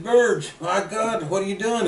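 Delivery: put-on startled to find an old friend there; surprised greeting.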